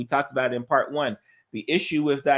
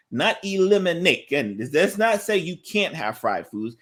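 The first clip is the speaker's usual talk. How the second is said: ordinary talk, nothing marked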